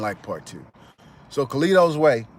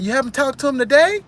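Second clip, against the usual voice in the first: high, louder probing question; mock suspicion; 13 semitones higher, 5 dB louder than usual. A thug probing the cousin, high probing voice. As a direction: press him with a rising question. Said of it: "And he said".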